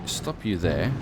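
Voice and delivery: ordinary talk, nothing marked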